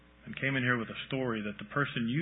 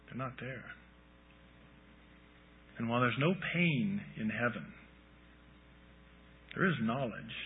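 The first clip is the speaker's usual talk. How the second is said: guided visualization. Acted. Guided imagining with eyes closed.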